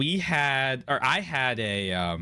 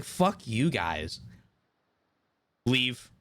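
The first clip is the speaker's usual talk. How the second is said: slow mock dismissal; much slower than usual. Retold anger at no-show.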